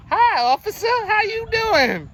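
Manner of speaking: high, loud, stretched-out fake cheer; put-on friendly greeting. Man greeting the police officer, exaggerated put-on politeness. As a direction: put on your best innocent hello for police. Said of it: "you put that on that face".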